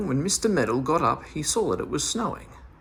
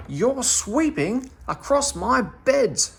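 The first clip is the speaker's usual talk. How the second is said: a high, slow, louder shout of outrage; 12 semitones higher, 4 dB louder, slower than usual. Acted. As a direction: Dame Fanny shouts at Meddle sweeping her flower beds, slow and high. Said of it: "she shouted".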